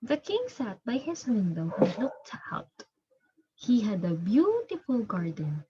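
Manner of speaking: clear, measured storybook narration for a class; storybook reading to children. A narrator's reading. Teacher reading the story aloud to her pupils, steady storybook voice. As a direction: read it like a teacher sharing a picture book.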